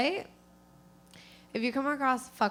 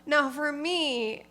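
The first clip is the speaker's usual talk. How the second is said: ordinary talk, nothing marked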